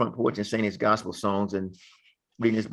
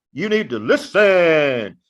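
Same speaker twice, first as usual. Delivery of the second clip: loud, slow, higher-pitched command; grandfather's drawn-out call; 5 semitones higher, 6 dB louder, much slower than usual. Retold or imitated. Grandfather telling the boys to pay attention, loud and drawn out. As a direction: stretch out LISTEN like a stern elder. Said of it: "my grandfather would say".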